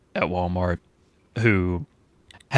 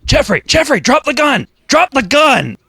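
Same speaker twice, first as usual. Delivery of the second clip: much higher and louder fake alarm; mock screaming; 9 dB louder than usual. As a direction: yell like a cop at a tripping coworker. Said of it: "start screaming at him".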